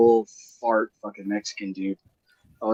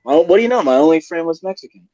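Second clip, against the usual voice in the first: sarcastic punchline; 6 semitones higher, 6 dB louder, faster than usual. Real, not acted. Sarcastic aside about jail friend.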